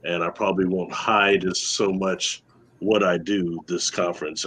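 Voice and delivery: ordinary delivery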